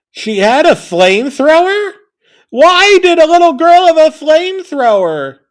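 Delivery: escalating disbelief, nearly shouting; shocked outburst. Real, not acted. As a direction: yell it in disbelief. Narrator shocked by the girl's flamethrower.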